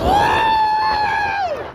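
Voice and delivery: high scream on the drop; coaster scream. Real, not acted. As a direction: let out a huge ride scream.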